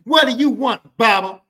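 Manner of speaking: high, loud, confrontational yell; acted doorstep challenge; sassy boyfriend. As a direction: demand it with big sassy attitude. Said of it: "That's how you have to do it".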